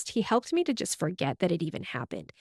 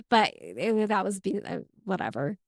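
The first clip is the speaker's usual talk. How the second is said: ordinary delivery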